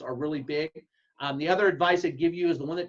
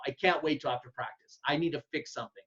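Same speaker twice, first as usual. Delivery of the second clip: ordinary talk, nothing marked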